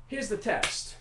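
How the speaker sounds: ordinary talk, nothing marked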